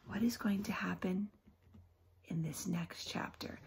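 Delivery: soft suspense whisper; whispered teaser. Real, not acted. Teacher teasing the next chapter, whisper. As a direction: hush it like a secret. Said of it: "teacher whispers teaser".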